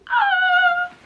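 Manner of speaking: very high, loud cry; long overwhelmed squeal; emotional wail. Real, not acted. Reader reacting to a book reveal, emotional wail. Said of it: "I'm feeling a lot of emotions".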